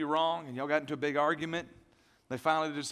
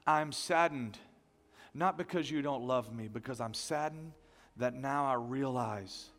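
repentant prayer; 4 semitones lower than usual. Acted. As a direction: pray it low and tender, with sorrow. Prays a model repentance prayer.

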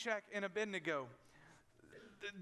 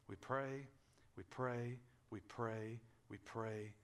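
each we pray softer and lower; dropping quieter with every we pray; fading refrain; 8 semitones lower, 6 dB quieter, slower than usual. Acted. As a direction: let the repeats sink to a hush. Repeated fading prayer refrain.

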